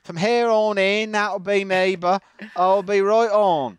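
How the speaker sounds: loud, high country-bumpkin accent; put-on rural accent. Shown as a demonstration. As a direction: declare it in a broad rustic accent. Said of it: "I won't speak in my real accent".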